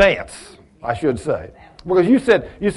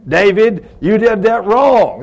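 mock stern callout; 5 semitones higher, 4 dB louder, slower than usual. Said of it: "jump up and say".

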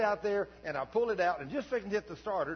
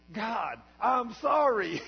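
higher, louder, slow pained cry; retold scream; 8 semitones higher, 10 dB louder, much slower than usual. Pastor with a burning eye cries out to God, screaming. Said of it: "And I started screaming".

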